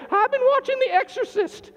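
high put-on voice of a guilty viewer; mock sheepish confession. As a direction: squeak out the confession. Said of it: "Where are you giving?".

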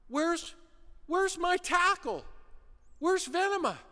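a coach calling for his missing tackle. Retold or imitated. Coach looking over the huddle, calling out.

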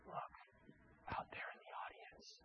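secretive stage whisper. Retold or imitated. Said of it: "and then Bugs says".

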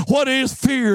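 ordinary talk, nothing marked

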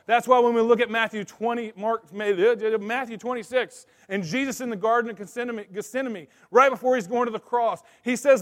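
high, loud pulpit preaching; full-force preaching. Acted. Pastor preaching to the men at full force.

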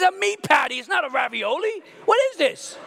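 high, loud outraged cry; outraged shout. Retold or imitated. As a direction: yell at the plate in disbelief.

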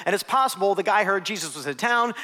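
usual speaking voice

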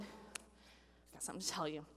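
whispered, low and hushed; whispered confession to parents; nervous confession. Retold or imitated. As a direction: breathe it out like a scared teen confessing. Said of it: "you're like".

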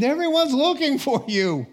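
high, loud exclamation; exclaiming. Acted. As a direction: call it out urgently, pitched well up. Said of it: "they exclaimed".

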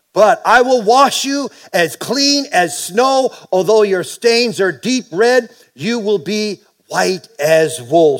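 slow, high, emphatic scripture reading. Acted. Pastor reading Isaiah to the congregation, slow and emphatic. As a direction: lay each promise down slowly.

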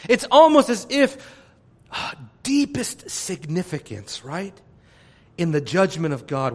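ordinary talk, nothing marked

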